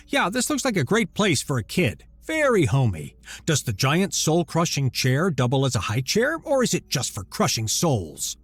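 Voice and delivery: biting sarcasm, loud and high; sarcastic. Acted. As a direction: sneer at the room. Husband mocks throne room.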